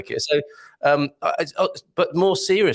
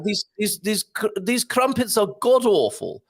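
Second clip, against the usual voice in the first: high, slow, regal English disapproval; the Queen; 6 semitones higher, slower than usual. Acted. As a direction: pronounce it slowly like a monarch. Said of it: "if it was the Queen saying it".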